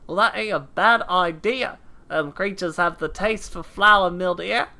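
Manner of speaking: put-on hammy British accent drifting into Australian; muddled British-Australian character accent; struggling put-on accent. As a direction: play a gruff game veteran in a wobbly accent. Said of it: "I'm gonna die doing this accent".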